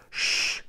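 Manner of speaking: long voiceless shush. Acted. Queen shushes her crying son so guards won't hear, shushing. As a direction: hush someone urgently with a shh. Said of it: "shushed the queen".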